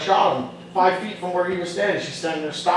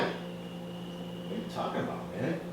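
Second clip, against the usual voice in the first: soft, breathy whisper of confusion; hushed confused reply; 4 semitones lower, 20 dB quieter, slower than usual. Retold or imitated. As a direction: whisper it, puzzled.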